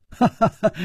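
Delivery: light, high staged laugh; short laugh. A narrator's reading. Hero amused by the wife's threat, short dismissive laugh. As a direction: give a brief unbothered ha-ha-ha.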